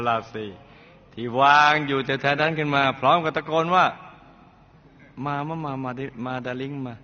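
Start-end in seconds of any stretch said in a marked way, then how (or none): 4.5-6.9 ท้าแบบหวานหยด ช้าลงมาก และเบาลง; ยั่วให้เข้ามาด้วยน้ำเสียงอ่อนหวานเชิงประชด; ท้าทายแบบหวานเกินไป. Retold or imitated. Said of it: "หวานไปใช่ไหม". (คุณแม่ถือมีดท้าพ่อเลี้ยง พูดช้าหวานเชิงประชด)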